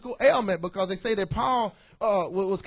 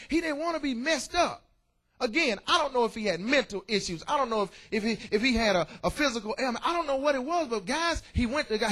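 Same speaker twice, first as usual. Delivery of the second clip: ordinary talk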